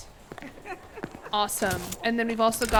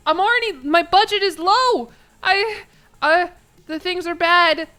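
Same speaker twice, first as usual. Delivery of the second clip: alarmed; 6 semitones higher, 9 dB louder than usual. Real, not acted. Panicking as budget collapses.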